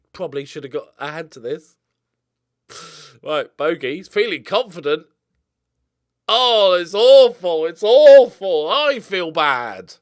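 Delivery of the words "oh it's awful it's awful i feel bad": loud, slow, pained laughing wail; laughing wail over a terrible goal; loud mock anguish. Real, not acted. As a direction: howl at the disaster. Reacting to goalkeeper blunder.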